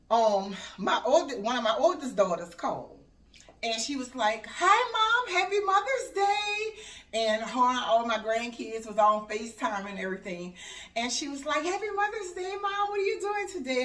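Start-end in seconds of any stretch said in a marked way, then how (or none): 4.5-6.8 high, louder cheery call; imitated daughter's greeting. Oldest daughter calling mom on FaceTime, imitated high greeting. 11.6-13.8 high-pitched chirpy quote; imitated daughter's greeting. Oldest daughter checking in on mom, imitated high chirp.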